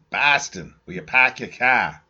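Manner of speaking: mock Boston accent, "car" as "kah". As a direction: ham up a Boston drawl. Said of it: "Where are we from?".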